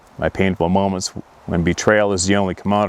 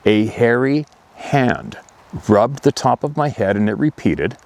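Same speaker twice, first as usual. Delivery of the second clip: ordinary delivery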